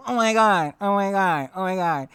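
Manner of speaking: a light take on a panicky Kevin Hart, starting high then settling; Kevin Hart impression. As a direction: toss off a quick Kevin Hart "oh my God" bit that fades. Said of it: "How he does his impressions".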